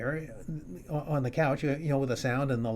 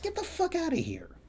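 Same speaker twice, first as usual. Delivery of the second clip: mock tough order; 3 dB quieter than usual. Ordering the intruder out.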